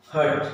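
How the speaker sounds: हर्ट with a tiny, tucked-in R; R kept inside. Shown as a demonstration. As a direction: swallow the R in हर्ट. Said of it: "अंदर ही अंदर". (हर्ट में र अंदर ही अंदर)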